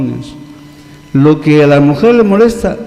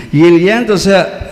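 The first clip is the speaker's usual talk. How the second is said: ordinary talk, nothing marked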